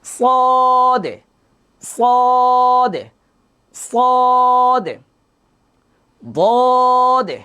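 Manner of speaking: সদ and বদ held long and high, drawn-out vowels; sustained recitation. Shown as a demonstration. Teacher drilling the letter sound for the class. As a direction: chant each সদ slowly for students to copy. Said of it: "আমার মুখে খেয়াল করেন".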